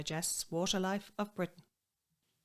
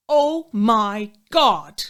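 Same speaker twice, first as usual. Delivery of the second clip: very loud, drawn-out mock horror; hammed-up, higher cry of outrage; scandalised outcry; 4 semitones higher, 13 dB louder, much slower than usual. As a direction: belt out each word slowly, as if scandalised. Mock outrage at the busybody's insult.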